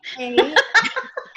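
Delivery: loud laugh rising up; burst of laughter. Real, not acted. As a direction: laugh out at the joke. Laughs at host's remark.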